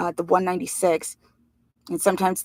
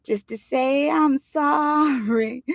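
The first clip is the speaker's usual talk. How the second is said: high, very slow crooning falsetto; dreadful falsetto; 7 semitones higher, much slower than usual. Acted. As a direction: croon it like a bad doo-wop singer. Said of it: "in a dreadful little Anthony falsetto".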